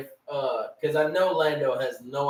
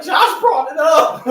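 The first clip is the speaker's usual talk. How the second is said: mock defensive shout; 11 semitones higher, 8 dB louder than usual. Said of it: "I'm gonna be like".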